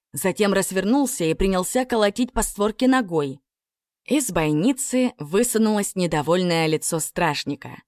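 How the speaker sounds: ordinary delivery, nothing marked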